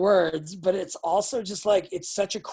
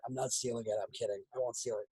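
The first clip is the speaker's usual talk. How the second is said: much softer, lower mock denial; quiet joking aside; 10 semitones lower, 11 dB quieter, faster than usual. Real, not acted. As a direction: mutter it under your breath as a joke. Joking aside after "I might steal.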